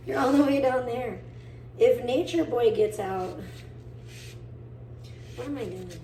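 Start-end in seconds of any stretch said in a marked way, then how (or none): none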